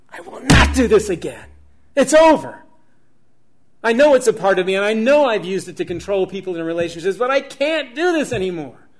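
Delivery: a resolved man shouting his promise; fervent resolve. Retold or imitated. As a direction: declare the vow with full force. His retold resolve to change.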